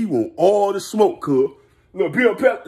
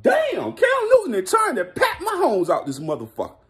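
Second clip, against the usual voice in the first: high, shouted disbelief; mock stunned yell from the doubters; "Damn" shot way up in surprise; amazed shout; 8 semitones higher than usual. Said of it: "They like".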